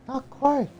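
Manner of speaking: put-on high falsetto girl voice; squeaky high-pitched heroine; Mulan voice.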